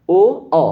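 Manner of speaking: ओ pushed loud from the throat, lips rounded; o sound demo. Shown as a demonstration. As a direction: stress ओ from the throat. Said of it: "कंठ पे जोर पड़ रहा है". (ओ को कंठ पर जोर देकर)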